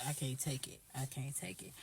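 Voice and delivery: whispered, at the end of her rope; fed up with the scene, whispered to herself. Retold or imitated. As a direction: breathe it out like you can't bear another second. Exasperated inner thought.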